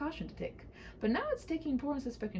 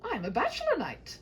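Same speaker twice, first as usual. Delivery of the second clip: a bold knight calming a frightened maiden; gallant knight; 8 semitones higher, 5 dB louder than usual. Acted. Knight reassuring the maiden, high and grand.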